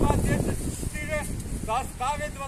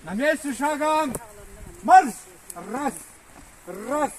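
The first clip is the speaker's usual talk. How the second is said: loud barked drill commands; shouting drill sergeant; 6 dB louder, slower than usual. Real, not acted. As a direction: shout marching orders to a squad. Drill commands to marching squad.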